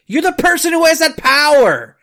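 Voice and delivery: ranting loud at her from the gut; real angry rant. Real, not acted. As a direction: let the anger boil over. Ranting at the politician.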